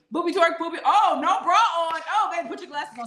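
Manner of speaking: high, loud hooting; high-pitched loud delight; excited. Real, not acted. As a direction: whoop at the sight. Reacting to a viewer's dancing.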